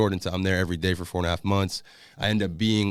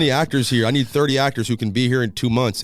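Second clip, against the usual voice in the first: louder, higher crowd call; 3 semitones higher, 5 dB louder than usual. Retold or imitated. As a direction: call out over four hundred people. Random guy calling out to crowd.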